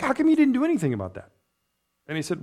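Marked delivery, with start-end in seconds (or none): 0.0-1.3 higher, fast "how come you didn't"; preacher asking the Lord why he didn't act; puzzled question. Retold or imitated. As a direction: ask the Lord in quick bewilderment. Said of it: "he's like".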